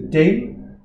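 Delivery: dem with an open E; dem, lifted high, vowel open; German vowel demo. Shown as a demonstration. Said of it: "it's a bit of an E".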